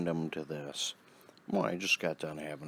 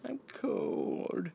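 mock whiny mother, much higher; mocking mom. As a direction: imitate her high complaint. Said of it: "She snapped at me".

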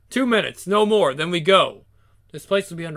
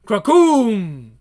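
loud voiced thunder crack; thunder crack sound; 3 semitones higher, 7 dB louder than usual.

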